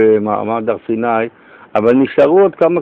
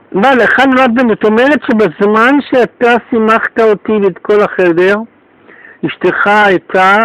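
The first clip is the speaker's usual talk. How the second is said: very high, loud and quick; astonished outburst; 11 semitones higher, 5 dB louder than usual. Retold or imitated. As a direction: gush with disbelief. Host re-enacts his astonished outburst. (התפעלות רמה וגבוהה מאוד)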